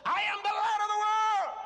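ringing, full-voiced proclamation; bold declaration. Shown as a demonstration. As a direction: shout it as if you finally believe it. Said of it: "How many times have you said".

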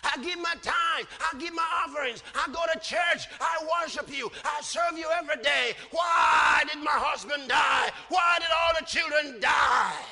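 mock anguished cry to God; grieving woman's cry. Widow crying out to God, hammed-up anguish. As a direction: plead to God as the bereaved widow. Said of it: "She said".

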